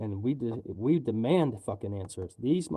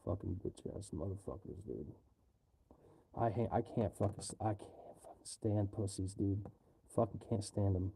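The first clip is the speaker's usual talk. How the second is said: low muttering; 5 semitones lower, 9 dB quieter than usual. Real, not acted. Muttering insults about cowards.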